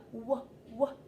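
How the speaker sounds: "w" said as a short "wuh", twice; letter sound demo. Shown as a demonstration. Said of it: "w sound".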